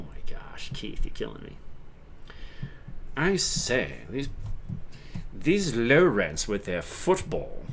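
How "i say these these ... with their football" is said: slow, put-on uppity British accent. Uppity Brit complains about football crowd, haughty British accent. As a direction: look down on them in hammed-up posh British. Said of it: "uppity Brit".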